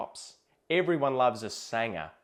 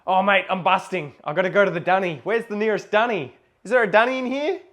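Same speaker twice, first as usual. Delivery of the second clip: higher, louder desperate plea; urgent; 7 semitones higher, 7 dB louder, faster than usual. Acted. Asking a mate for the nearest dunny, urgent and loud. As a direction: call out as if bursting to go. Acted urgent need for a toilet.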